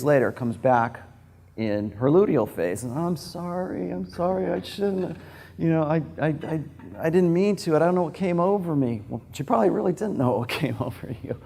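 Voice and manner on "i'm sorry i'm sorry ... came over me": high, pleading woman's apology. Retold or imitated. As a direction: beg forgiveness in a higher voice. Woman apologising after storming out.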